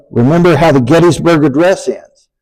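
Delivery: normal talking voice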